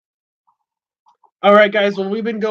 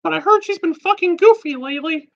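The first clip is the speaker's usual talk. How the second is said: very high squeaky cartoon mouse voice; Mickey Mouse voice; 11 semitones higher than usual. Prank-calling Walmart as a high cartoon mouse. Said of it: "It's me, Mickey Mouse".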